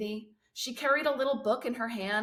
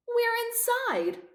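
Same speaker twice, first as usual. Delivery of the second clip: exasperated; slower than usual. A narrator's reading. Mop exasperated, high and slow.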